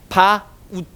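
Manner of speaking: "바, 웃" split into two full syllables; "바, 웃" with a clear break between the vowels; Korean-style pronunciation. Shown as a demonstration. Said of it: "우리끼리는 이거 뭐라고 발음해".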